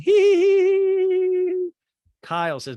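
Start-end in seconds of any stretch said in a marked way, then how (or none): 0.1-1.7 very high, loud squealed mock laugh; one long piercing fake "hee"; Diana. Recapper imitating a castmate laughing at the fight, squealed cackle. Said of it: "And Diana's laughing".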